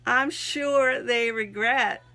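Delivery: slow, emphatic recitation with measured pauses; slow inspirational poem reading; devotional reading. Acted. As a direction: read your testimony aloud, phrase by phrase. Reads written devotional piece aloud.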